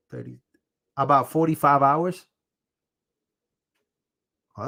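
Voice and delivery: ordinary delivery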